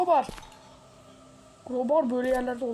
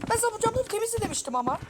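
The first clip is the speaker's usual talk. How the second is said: mock granny scolding; 10 semitones higher, faster than usual.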